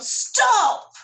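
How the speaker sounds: high, loud exasperated yell of Stop. A narrator's reading. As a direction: parents yelling to halt the chaos. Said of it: "but her parents yelled".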